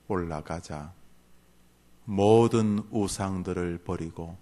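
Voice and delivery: low, soft, measured sermon voice with pauses; solemn hushed preaching. Acted. Preacher telling the congregation of Jacob's call to Bethel, hushed and low. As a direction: drop right down and let each phrase settle. Turns solemn for next chapter.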